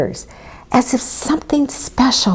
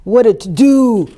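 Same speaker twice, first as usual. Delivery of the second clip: booming ox; 4 semitones higher, 4 dB louder than usual. A narrator's reading. Ox remarking on the commotion, booming. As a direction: boom it like an ox. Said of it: "boomed Ox".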